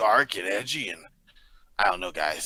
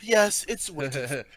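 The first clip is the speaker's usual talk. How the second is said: butler voice; 3 semitones higher, slower than usual. Acted. Butler voice, slow and far.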